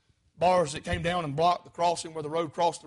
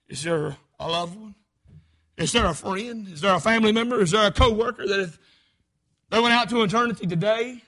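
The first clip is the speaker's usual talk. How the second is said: normal talking voice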